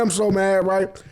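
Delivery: ordinary talk, nothing marked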